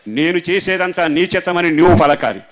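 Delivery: loud, high shouted prayer; preaching at full force. Acted. Pastor crying out the prayer to the congregation, at full force. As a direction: proclaim it to the back row.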